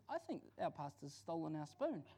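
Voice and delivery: soft, hushed accusation; confided suspicion. Retold or imitated. Wife tells husband her suspicion, hushed. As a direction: confide it softly.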